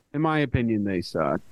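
tone it down to a mere opinion; softened opinion. Shown as a demonstration. Podcaster showing what is really meant, softened. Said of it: "like, I don't mean that".

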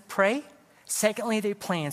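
ordinary talk

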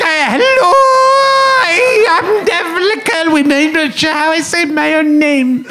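high-pitched, drawn-out bad Irish; slow, squeaky mock-Irish accent. Shown as a demonstration. Comedian demonstrating Dervla Kirwan to friend, hammed-up Irish accent. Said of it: "Can you do an Irish accent?".

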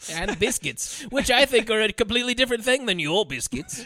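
hammed-up British explanation; mock British accent. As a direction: lecture like a cartoon Brit. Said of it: "I'm British".

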